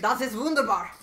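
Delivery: mock German. Joining the German joke, mock accent. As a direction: declare it in a playful German accent. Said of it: "Is she German now?".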